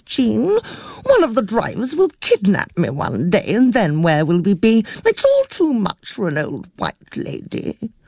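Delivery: put-on high, fussy old lady near tears; a fretful elderly aunt complaining breathlessly; flustered old aunt. A narrator's reading.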